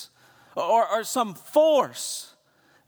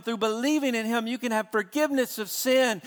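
ordinary delivery

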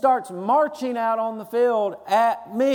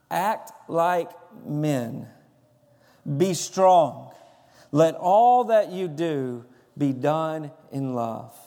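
slow, solemn reading of the verse; scripture reading; 3 semitones lower, slower than usual. Acted. Pastor reading scripture to the standing church, solemn. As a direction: read the verse with weight, word by word.

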